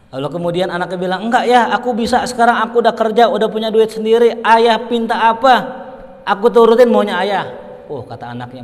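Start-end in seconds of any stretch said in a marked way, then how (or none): 1.3-7.4 raised high and loud, the son full of eager pride; eager insisting son. Acted. Grown son boasting to his father, eager and loud. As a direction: push it out, proud he can pay his father back.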